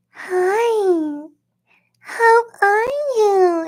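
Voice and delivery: high, slow, sugary put-on greeting; mock sister greeting. Mocking her patronizing sister's sweet hello. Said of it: "she was".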